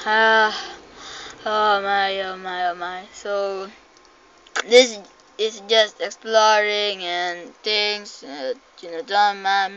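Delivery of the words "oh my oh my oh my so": ordinary talk, nothing marked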